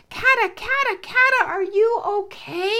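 high, loud worried call from above. Acted. Lion cub calling down from the bridge, loud and high. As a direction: shout down to a friend who fell in. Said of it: "he called".

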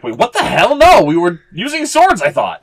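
high, loud baffled squawk breaking into a laugh; confused outburst. Acted. Leader sees the bow come out, high baffled squawk. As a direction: let it climb in disbelief then crack up.

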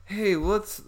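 high, badly faked Russian accent. French neighbor greeting her, accidental Russian accent. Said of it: "now I'm putting on a Russian accent".

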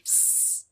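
hissed S, unvoiced; just the S, whispered; whispered S sound. Shown as a demonstration. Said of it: "pronunciar el sonido z como una S". (S susurrada)

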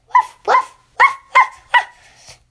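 loud, high-pitched sheepdog woofs; high, loud imitation barks; dog barking. A narrator's reading. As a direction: bark sharply like an excited sheepdog. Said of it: "and he barked".

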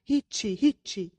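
loud, short cry of denial; shouting. A narrator's reading. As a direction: shout it out, hitting each word hard. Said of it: "ایرا فریاد زد".

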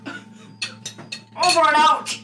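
slow, drawn-out radio sign-off. Acted. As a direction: sign off slowly over the comms.

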